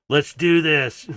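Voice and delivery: loud, drawn-out rallying cry; battle rally. Acted. Bots working together head into battle, rallying. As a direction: launch the charge, slow and loud.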